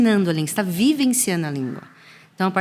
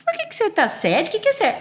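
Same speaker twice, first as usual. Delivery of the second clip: put-on much higher, puzzled old-lady questions; imitating puzzled grandma; 13 semitones higher than usual. Grandma confused by the English word, high puzzled voice. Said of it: "minha mãe fala, fala assim".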